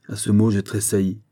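ordinary delivery

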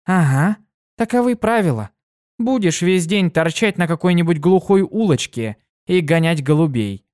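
высокий бойкий девичий голос; звонко и живо, как юная пегаска; бойкая пегаска. Acted. Дитси поддразнивает. (Дитси рассказывает другу, высоко и бойко)

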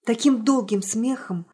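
plain reading voice

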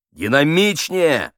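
loud, high, drawn-out call; stretched, insistent rehearsal order; urging director. Acted. Director calls for more energy.

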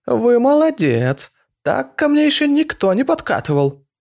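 high, slow, brassy mocking voice; loud laughing old woman; brash old woman. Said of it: "громко хаббалисто захохотала".

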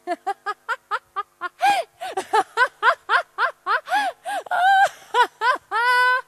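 a long bright burst of laughter; delighted laugh. Acted. Woman laughing with delight at a job offer. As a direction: laugh out loud with joy at the news. Joyful news of Leipzig job.

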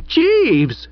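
very high, drawn-out call; scream. A narrator's reading. Pauline screams for the butler. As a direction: scream the name, pitch falling at the end. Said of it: "screamed Pauline".

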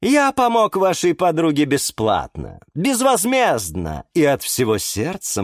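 puffed-up flower wizard laying down rules; pompous wizard. A narrator's reading. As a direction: play the grand hyacinth magician. Eduard explains magic.

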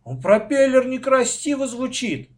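high, loud sibling objection; quarrelling child's protest; arguing. A narrator's reading. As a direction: argue back heatedly. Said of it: "стал спорить мой брат".

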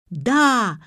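yelling. A narrator's reading. Pensioner answers the man who spilled cocoa on him, yelling. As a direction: yell it as an outraged old man. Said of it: "заорал пенсионер".

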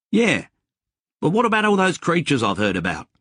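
worried mate. Acted. Mate by the car asking about creatures, high and fast.

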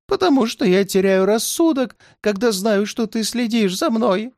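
high, frantic outcry; desperate old man. A narrator's reading. Kanzer cries out to his tormentor, high and desperate. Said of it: "закричал канцер".

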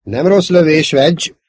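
loud, high approving call; hearty praise. Acted. Pilot congratulating Wedge on his shot, hearty. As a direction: call out praise over the battle.

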